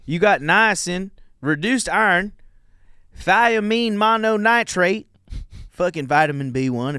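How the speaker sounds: put-on Southern accent. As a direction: read the label like an outraged Southerner. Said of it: "I'll read 'em in a Southern accent".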